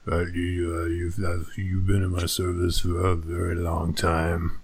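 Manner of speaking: low, mumbled mobster voice; gun runner voice. Acted. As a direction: mumble darkly like a Godfather knockoff, drawing out the threat. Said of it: "give me a little gun runner voice".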